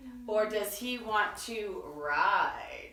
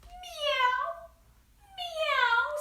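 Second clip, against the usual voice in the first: high, rising imitated meow; cat meow. Cat answering duck with a high meow. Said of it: "said Cat".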